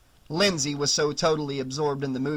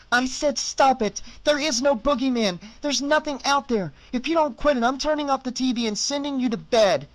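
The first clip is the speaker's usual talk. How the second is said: firm, raised scolding voice; stern warning; 9 semitones higher than usual. Acted. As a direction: threaten like a fed-up babysitter. Sitter threatens the boy.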